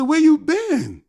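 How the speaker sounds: retold happy shout; excited holler. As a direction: greet the old student loudly. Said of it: "I think we hollered".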